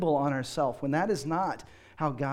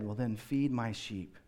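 softly and lower, a tender reply; gentle Jesus; 6 semitones lower, 7 dB quieter than usual. Acted. Jesus quietly answering Peter, soft and tender. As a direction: let the answer land gently, as forgiveness.

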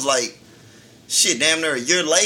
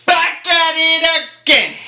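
catchphrase; 11 semitones higher, 5 dB louder than usual. Acted. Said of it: "that's the slogan now".

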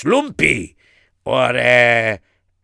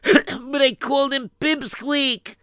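tearful; 11 semitones higher than usual. Acted. Boy confesses, voice breaking.

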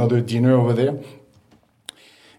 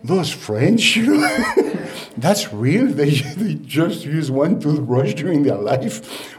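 imitating her, higher and laughing; laughing mock disbelief; 3 semitones higher, slower than usual. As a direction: play the amazed hostess, giggling. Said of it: "she was just like".

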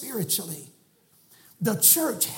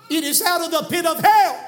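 much louder, higher repeat; preaching shout; 7 semitones higher, 8 dB louder than usual. Real, not acted. Preacher shouting that adrenochrome comes from hell. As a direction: thunder it from the pulpit.

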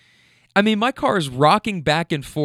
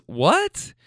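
higher, sharply rising what; puzzled question; 4 semitones higher than usual. Retold or imitated. Wife hearing husband's odd confession, puzzled rising question. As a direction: retell her baffled reaction.

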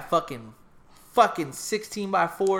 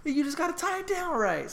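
mock dad correcting; 13 semitones higher than usual.

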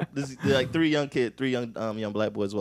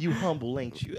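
retold kid's question; 11 dB quieter than usual. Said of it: "like a nine-year-old".

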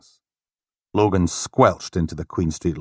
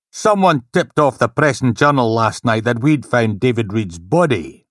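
angry inspector; 5 semitones higher, 5 dB louder than usual. Acted. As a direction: lay down the law angrily. Angry inspector lecturing team.

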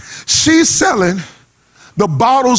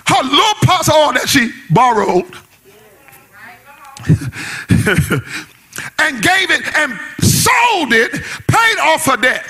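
high, shouted whoop over the organ; full-force preaching climax; shouting preacher; 5 semitones higher than usual. Acted. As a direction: hoop it up at the peak. Preaching peak, shouting over music.

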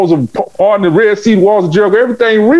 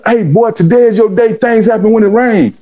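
lightly voiced young Haitian kid, higher; a hint of an excited kid; young kid's voice; 8 semitones higher than usual. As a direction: Haitian kid telling his big brother his day has come.

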